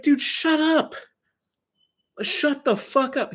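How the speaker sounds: very high, strained exasperation; exasperated. Acted. Customer silencing a clerk who won't stop, exasperated. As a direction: plead at the top of the pitch range, fed up.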